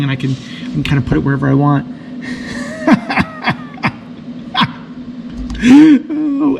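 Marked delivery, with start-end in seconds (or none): 1.9-6.4 squealing laugh at own joke; high-pitched laughing fit. Real, not acted. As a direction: burst out in a long high laugh. Laughing at innuendo.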